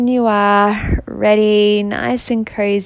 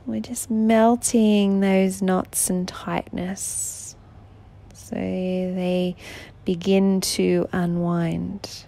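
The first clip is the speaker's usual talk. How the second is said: guided relaxation. Acted. Guiding a listener in bed through breathing before sleep, soothing meditation voice. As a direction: gently lull the listener towards sleep, unhurried.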